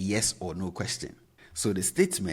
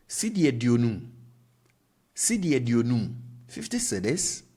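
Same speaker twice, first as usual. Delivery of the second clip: Cedi aduonum said higher and louder as a question; question tune; 4 semitones higher, 6 dB louder than usual. Shown as a demonstration. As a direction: lift and lengthen Cedi aduonum. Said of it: "just vary your tone and lengthen it a bit".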